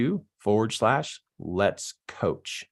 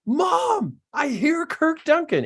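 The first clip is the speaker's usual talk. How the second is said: imitating a kid yelling, very high-pitched; high child's call to mom; kid's yell; slower than usual. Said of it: "he's yelling to his mom".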